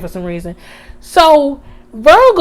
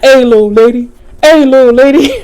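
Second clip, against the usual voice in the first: louder, soothing cop voice; mock a big cop calming her; imitating the cop; 6 dB louder, faster than usual. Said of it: "he like".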